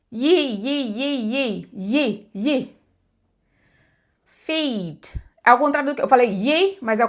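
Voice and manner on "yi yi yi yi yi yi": "yi" stretched like two I sounds glided together; long English EE; long I demo. Shown as a demonstration. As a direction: draw out "yi" as a double vowel. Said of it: "finge que tem dois sons de vogal ali".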